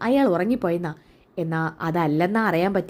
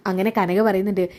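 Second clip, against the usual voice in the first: usual speaking voice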